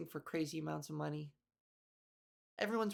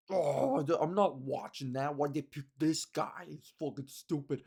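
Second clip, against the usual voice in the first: lower, dismissive complaining about casting; mock whiny critic; 5 semitones lower, 3 dB louder than usual. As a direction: grumble like a know-it-all fan. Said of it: "people are like".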